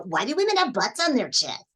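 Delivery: put-on puzzled, childlike anime question with swooping pitch; Goku. Shown as a demonstration. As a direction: ask it like a naive cartoon boy. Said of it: "for Goku".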